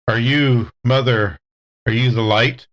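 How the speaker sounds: usual speaking voice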